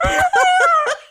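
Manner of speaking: high-pitched, near-silent fit of laughter; helpless high laugh. Real, not acted. Friend's story of a YouTuber sets off a high laughing fit. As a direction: lose it completely, squeaking with laughter. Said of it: "full mouth scream with no noise".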